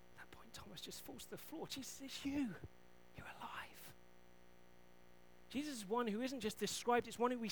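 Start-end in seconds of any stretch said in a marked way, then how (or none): 1.7-3.9 hushed, breathless recognition; awed whisper. Retold or imitated. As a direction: whisper it in awe. Thomas awed on the floor.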